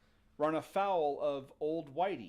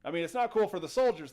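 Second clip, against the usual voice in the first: usual speaking voice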